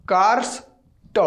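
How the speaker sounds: "कार्स" then a pause, then a separate "ट"; broken into parts. Shown as a demonstration. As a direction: split "कार्स ट" in two with a gap before the t. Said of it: "ऐसे बोलिए".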